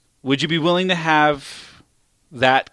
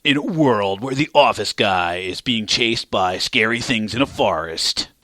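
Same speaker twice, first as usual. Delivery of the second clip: movie trailer voice; 2 semitones lower than usual. Acted. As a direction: boom it like a trailer announcer.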